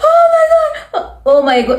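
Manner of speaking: high, frightened cry of oh my God; startled scream. Retold or imitated. Startled by a man rushing past, retelling her high scream. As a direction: shriek as if jumped at. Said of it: "I screamed".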